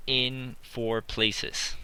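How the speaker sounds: slow, with in leaned on; stress on in. Shown as a demonstration. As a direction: lean on in in "in for places". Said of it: "we use".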